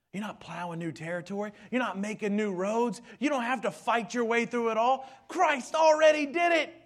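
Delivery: fiery preaching that ends in a shout; building preach. Acted. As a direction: climb each line until you shout it.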